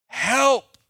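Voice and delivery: high, loud cry of "Help."; urgent cry. Shown as a demonstration. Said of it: "Best prayer you can ever pray".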